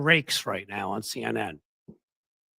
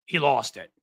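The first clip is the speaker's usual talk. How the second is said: normal talking voice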